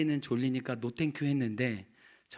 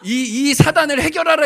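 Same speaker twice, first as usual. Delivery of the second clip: much higher, louder mock decree; loud, high imitating command; 14 semitones higher, 17 dB louder than usual. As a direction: ham up the wife's order to fix it. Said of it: "저희 아내가 발로 막 저를 치는 거예요".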